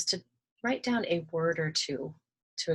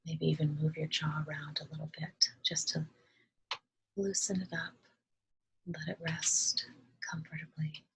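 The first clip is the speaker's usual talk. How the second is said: calm, soft meditation voice with long pauses; unhurried, hushed instructions, leaving space to breathe; guided meditation; 5 dB quieter than usual. Acted. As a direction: gently guide listeners through relaxing each body part.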